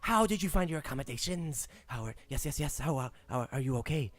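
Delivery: a squeaky, eager rat lord; Rat King voice. Acted. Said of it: "the Rat King enters the room".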